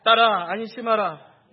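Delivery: loud, slow, measured unison-style reading; scripture reading aloud. Real, not acted. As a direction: lead the congregation through the verse. Leads congregation in reading scripture.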